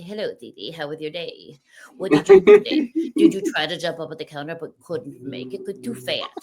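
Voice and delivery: a light put-on voice for the cat; talking to my cat. Talking to her cat Cece in a lightly silly voice. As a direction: coo at the cat in a silly little voice. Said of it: "that's how I used to talk to my cat".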